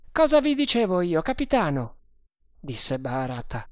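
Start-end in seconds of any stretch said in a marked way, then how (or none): 0.1-2.0 higher-pitched, quick sergeant voice; animated sergeant. A narrator's reading. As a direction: pitch it high and fire it off to the captain. Sergente si rivolge al capitano. (voce acuta e concitata da sergente)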